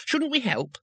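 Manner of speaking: high, earnest little voice; eager tourist voice. Acted. Two Flower asks.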